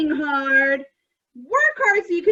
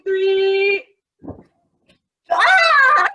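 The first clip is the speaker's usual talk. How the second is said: loud, rising countdown to a cheer; excited countdown; 6 semitones higher, 7 dB louder, much slower than usual. Acted. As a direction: hype the kids up, getting louder.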